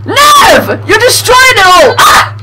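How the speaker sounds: outraged gamer yelling at Nev; real furious yelling. Real, not acted. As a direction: scream his name to stop him. Friend wrecking his car in game.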